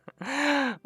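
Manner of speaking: delighted high laugh; high burst of laughter. Real, not acted. Gamer cracking up over the kill spree, delighted laugh.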